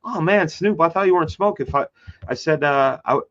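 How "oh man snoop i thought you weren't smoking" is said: higher, playful ribbing; teasing. Retold or imitated. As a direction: tease him like a buddy. Teasing [name] in retelling.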